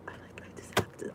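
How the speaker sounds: breathy whispered self-talk. Real, not acted. Whispering to herself about stopping the snacking. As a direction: whisper it under your breath.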